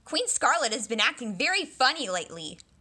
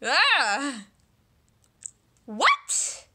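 ordinary talk

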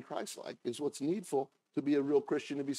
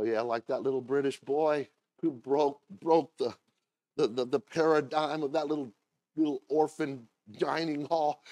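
ordinary talk

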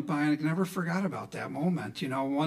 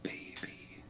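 very soft, low murmur; low, hushed pet name; intimate murmur; 7 semitones lower, 14 dB quieter than usual. Retold or imitated. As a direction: murmur it gently into a sleeper's ear. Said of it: "my mouth right by her ear".

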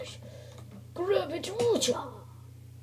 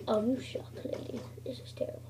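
whisper; 4 semitones lower, 8 dB quieter than usual. Real, not acted. Grumbling at the lady.

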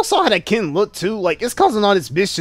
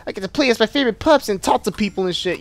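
put-on higher, eager kid voice; excited little kid; 5 semitones higher than usual. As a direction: gush like a thrilled child. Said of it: "being like".

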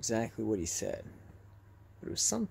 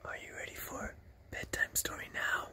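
breathy whispered bedtime tease; whispered spooky bedtime offer; 11 dB quieter than usual. Pretend. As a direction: whisper like a creepy storyteller. Spooky filter, teasing viewers.

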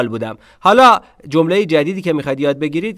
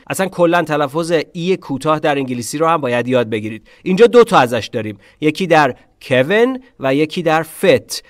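normal talking voice

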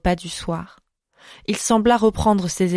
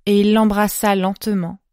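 usual speaking voice

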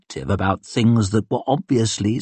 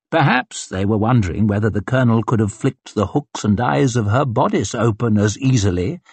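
usual speaking voice

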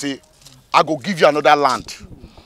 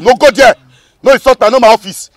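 high, raised-voice objection; angry warning; 5 semitones higher, 4 dB louder than usual. Acted. Official warning a buyer not to insult him, offended. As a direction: warn them off, loud and offended. Acted quarrel over insult.

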